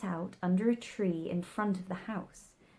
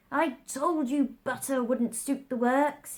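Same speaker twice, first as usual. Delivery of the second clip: angry; 6 semitones higher, 4 dB louder than usual. A narrator's reading. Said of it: "looking angrily at the March Hare".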